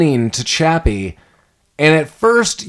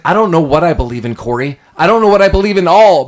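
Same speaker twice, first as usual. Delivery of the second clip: anguished; 4 semitones higher, 5 dB louder, faster than usual. Retold or imitated. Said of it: "He's like".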